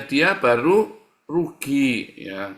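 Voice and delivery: ordinary talk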